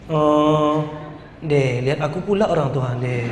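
low, halting filler-laden mumble; poor presenter. Acted. Coach imitating a presenter avoiding eye contact, low and hesitant. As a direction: stall and drone on a dropped pitch. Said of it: "ada orang macam begini?".

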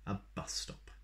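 usual speaking voice

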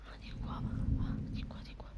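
hushed whisper. Real, not acted. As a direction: whisper so nothing hears you. Whispering while sneaking.

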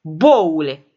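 put-on a loud, furious old woman's snap; exploding insult. Old woman explodes at her nephew, loud insult. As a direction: blow up at him in one word. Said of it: "explodă bătrâna".

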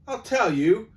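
high, loud eager start; eager fat shopkeeper. Acted. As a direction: jump in to tell the tale.

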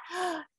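soft gasp. Real, not acted. Reader gasps at picture.

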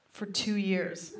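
slow, dropped and quiet: for two years. Acted. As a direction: let for two years fall away, dragged out. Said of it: "repeated for effect".

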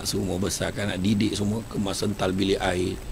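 ordinary delivery

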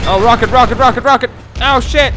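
high, loud alarmed shouting; yelling in alarm as the rocket comes in; panicked yell. Real, not acted. Gamer yelling in panic as a rocket comes at him.

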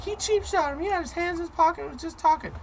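high, thin mock-bystander voice; bystanders' outraged voice. Onlooker mocking a fight he saw, high squeaky voice. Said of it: "they'd be like".

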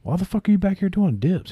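high, exasperated demand, imitating Jericho; mock-scold a friend for overtraining; incredulous yell. Jericho scolding Benoit in the back, high incredulous yell. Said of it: "Jericho's like".

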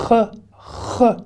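CH raclé, isolé, sans voyelle; démonstration du chet. Shown as a demonstration. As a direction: isole le son CH comme exercice. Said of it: "Il faut vous entraîner". (« ch » guttural prononcé seul, en exemple)

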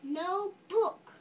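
firm, loud refusal; angry part. Acted. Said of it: "This is the angry part".

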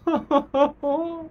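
high, hooting laugh of amazement; delighted laugh. Real, not acted. Fan hearing [name] sing, laughing in delight. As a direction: burst into high, thrilled laughter. Overcome by the singer's voice.

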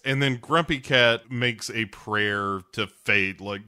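usual speaking voice

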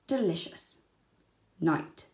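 plain reading voice

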